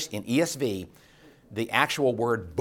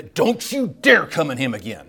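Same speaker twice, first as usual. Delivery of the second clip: loud, firm warning to the demon; stern rebuke; 3 semitones higher, 9 dB louder than usual. Retold or imitated. Jesus warning the demon away, in a loud stern voice. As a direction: threaten it, forbid return. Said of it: "wouldn't you like to be".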